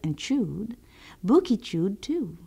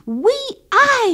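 much higher, loud yelp of pain; burning-mouth shout; 8 dB louder than usual. A narrator's reading. Old man yelps after biting a hot pepper, shouting high. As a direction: cry out as the pepper burns. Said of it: "he shouted".

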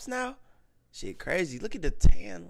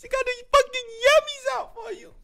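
much higher, louder excited yelling; hyped shout; 7 dB louder than usual. Real, not acted. Fan watching [name]'s video, shrieking high and loud. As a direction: lose it over the video, squealing.

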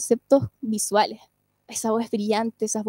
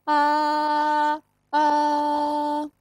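chest voice; 3 semitones higher than usual. Shown as a demonstration. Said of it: "la voz que utilizamos al hablar".

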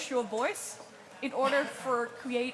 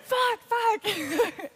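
laughing; 9 semitones higher, 6 dB louder than usual. Real, not acted. Laughing while cursing.

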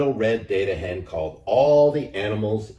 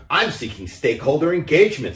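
higher, louder hen voice; earnest hen; 8 semitones higher, 5 dB louder than usual. A narrator's reading. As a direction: announce it like a busy hen. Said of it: "said the little red hen".

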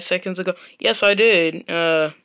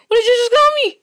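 high, loud, furious demand; a kid yelling at his mom who insulted him; outraged yell; 6 dB louder, much faster than usual. Acted.

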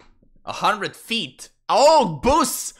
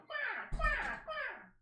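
very high, soft laughing quacks; mock parrot quacking; parrot quack imitation; 11 dB quieter, much slower than usual. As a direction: giggle out tiny parrot quacks. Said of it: "just sitting there and going".

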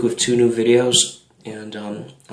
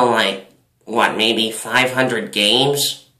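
put-on slurred, loose drunk speech. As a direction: talk like a tipsy old baseball fan. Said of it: "here's my drunk voice".

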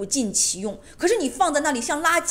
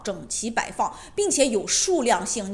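ordinary delivery

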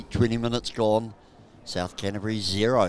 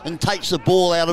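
high, loud burst of commentary; excited play-by-play; 8 semitones higher, 6 dB louder than usual. Real, not acted. Commentator spots another chance for a break, calling it excitedly. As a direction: jump up in pitch as the chance opens.

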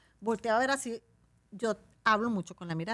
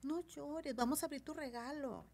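soft, higher comforting voice; soft coaxing; 6 semitones higher, 11 dB quieter than usual. Shown as a demonstration. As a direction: soothe a crying child. Consolando a un niño. (voz suave para consolar al niño)

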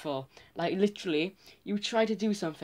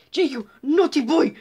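high, loud scolding mum impression; imitated angry shout, jumping up in pitch; 9 semitones higher, 11 dB louder than usual. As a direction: yell it like a mother telling off her son. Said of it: "Whenever she shouted, she'd be like".

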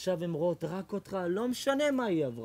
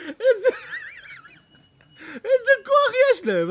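amazed cry; 9 dB louder than usual. Acted. Marvelling exclamation.

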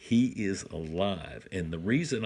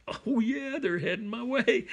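much higher, eager and thrilled; excited. Retold or imitated. Father retelling his thrill at deer approaching, excited. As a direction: squeal it like the deer are coming. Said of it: "I'm all excited going".

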